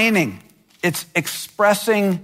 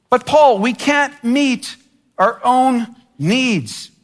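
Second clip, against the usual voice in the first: higher, slowing, drawn-out plea; whiny complaint; 6 semitones higher, slower than usual. Pretend. Believer complaining to Paul about unmet needs, high and slow. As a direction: stretch the grievance out slowly.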